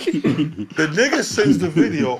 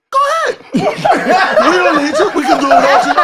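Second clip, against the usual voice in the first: higher, louder teasing voices; teasing Larry and crew; 12 semitones higher, 5 dB louder, faster than usual. Retold or imitated. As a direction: rib him like the cast shooing him off. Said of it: "Larry and them like".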